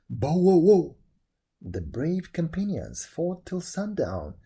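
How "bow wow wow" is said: very loud put-on bark; dog barking. A narrator's reading. Dog leaping into the castle courtyard, loud battle bark. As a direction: bark hard, as if attacking.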